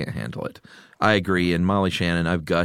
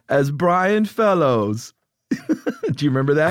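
high, slow character voice; mock TV host; Brian Fellows voice; 8 semitones higher, slower than usual. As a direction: stretch the name like the character. Naming the character in his voice.

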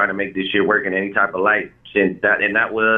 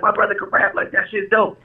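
put-on much higher, eager kid boasting; excited bragging; 10 semitones higher than usual. Kid bragging to school friends about his rapping brother, excited. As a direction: show off your brother proudly.

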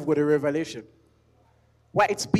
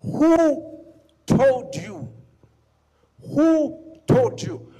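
emphatic challenge; 7 semitones higher, 5 dB louder, much slower than usual. Acted. Preacher challenging congregation.

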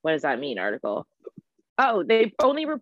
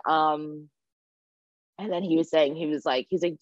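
ordinary talk, nothing marked

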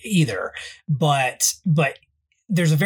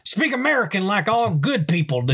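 put-on grumpy Southern old man; 5 semitones higher than usual. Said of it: "we always devolve into that guy".

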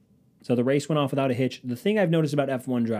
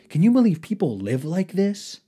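higher, louder hammed-up amazement; mock incredulity; 7 semitones higher, 6 dB louder than usual. Rich couple talking to each other, mock incredulous. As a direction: marvel at it like a clueless rich person.

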